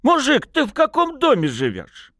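put-on high-pitched drunken lad; rowdy, nagging demand; tipsy challenge. A narrator's reading. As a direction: pester him with a high, insistent question.

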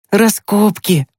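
put-on high boyish exclamation; excited exclamation. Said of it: "воскликнул Тимкин". (восторженно воскликнуть высоким голосом)

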